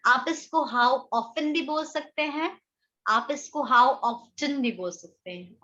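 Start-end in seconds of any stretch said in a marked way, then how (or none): none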